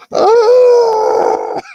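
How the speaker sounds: high, loud startled shriek; mock scream. Viewer shrieking at the lightsaber surprise. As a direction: shriek in fake alarm.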